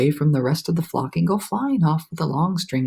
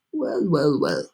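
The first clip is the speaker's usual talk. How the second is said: lightly voiced centipede, drawn out; centipede musing; slower than usual. A narrator's reading. Centipede peeking out at the rainbow, musing. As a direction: savour it, impressed.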